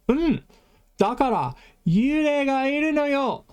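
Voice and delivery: high, bright puppy-like sidekick voice; Puppy Kohai voice. Acted. Puppy Kohai explains the ghost to the teacher in a high cute voice. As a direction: chirp it like an eager little junior. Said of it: "Puppy Kohai is responding by saying".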